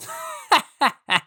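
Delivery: high, mocking grasshopper laugh. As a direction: laugh off the ant's warning. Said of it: "The grasshopper laughed at him".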